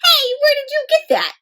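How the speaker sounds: high playful panda voice; high cheeky cartoon voice. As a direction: tease with a high silly voice. Panda with banjo.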